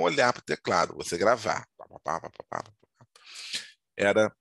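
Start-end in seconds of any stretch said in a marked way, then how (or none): 1.8-3.1 soft, low "pá-pá-pá" of typing keys; imitated typewriter clatter. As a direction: mimic keys tapping, quietly and low. Imitates typewriter keys being struck. (imitando baixinho o barulho da máquina de escrever)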